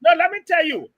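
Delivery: loud protesting shout; yelling. Real, not acted. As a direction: cut in with a yell. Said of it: "Uncle Sam, why are you yelling?".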